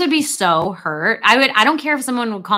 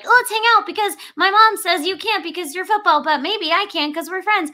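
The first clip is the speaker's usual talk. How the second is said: higher, a light hint of an eager kid; kid's voice; 9 semitones higher than usual. Quoting the boy Bo asking the football player to hang out, light kid voice. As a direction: lift into a hopeful little boy. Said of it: "when Bo's like".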